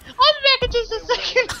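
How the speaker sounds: put-on very high-pitched decoy girl. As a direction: chirp it high, calling from inside. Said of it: "and she's like".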